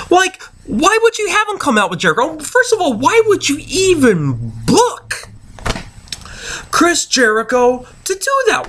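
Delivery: high-pitched, incredulous questioning; outraged fan pleading for logic; exasperated. Real, not acted. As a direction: demand an explanation for nonsense. Rants at a senseless booking decision.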